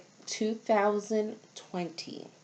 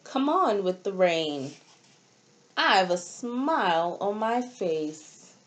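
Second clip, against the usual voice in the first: put-on reading to children. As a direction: read each line like a picture book page to a class. Reads storybook aloud to children.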